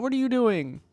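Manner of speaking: ordinary talk, nothing marked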